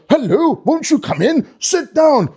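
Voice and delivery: high, swooping grand welcome; jolly Santa sing-song invitation; Father Christmas. Acted. Father Christmas inviting kids into the hut, jolly.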